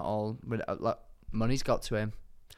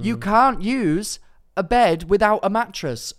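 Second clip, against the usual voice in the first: mock outrage; 9 semitones higher, 7 dB louder than usual. Ranting to co-host about beds without mattresses, loudly exasperated. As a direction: spell out the absurdity, loudly. Comic rant about bed sales.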